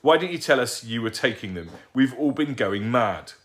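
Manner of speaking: outraged scolding. Acted.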